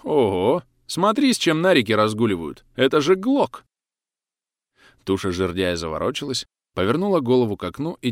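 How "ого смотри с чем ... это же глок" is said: louder, rising to a high amazed cry; surprised. Acted. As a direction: marvel at the gun. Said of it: "с удивлением говорит".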